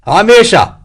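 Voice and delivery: high, loud answering shout; calling back. A narrator's reading. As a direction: shout back to your captain through the trees. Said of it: "Hegswell called back".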